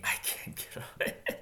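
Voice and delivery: mock the lazy friend through a laugh; laughing repeat. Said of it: "sounds like he's".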